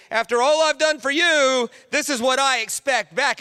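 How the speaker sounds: demanding god. Retold or imitated. Pastor voicing a fearsome god, stern and commanding. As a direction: lay down the debt. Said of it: "God might show up and say".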